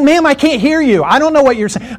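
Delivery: high, helpless protest; acted-out exasperated appeal; straining to communicate. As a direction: plead through a closed car window. Talking to driver through closed window.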